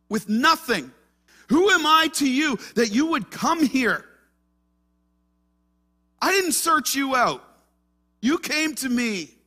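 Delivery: high, loud anguished plea to the prophet; grieving widow's plea. Acted. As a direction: cry out as the widow who lost her son. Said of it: "looks at him and says".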